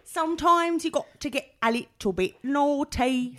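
high mock-British child voice; hammed-up little girl trying a British accent; auditioning little girl. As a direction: chant it like a kid at a British musical audition. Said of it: "little girls that auditioned for".

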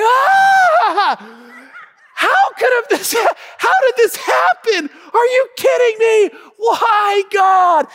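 high, loud anguished wail; mortified wail. Retold or imitated. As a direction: shriek it as if ruined. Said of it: "They were like".

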